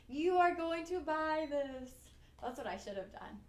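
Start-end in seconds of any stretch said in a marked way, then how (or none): 0.1-1.9 slow, commanding hypnotist intonation; mock-hypnotize the viewers. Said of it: "Nice try on the hypnosis".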